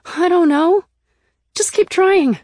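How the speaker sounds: high, loud, flustered exasperation; helpless. A narrator's reading. Narrator urges Nick to keep entering numbers, helpless. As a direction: throw up your hands vocally. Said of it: "I said helplessly".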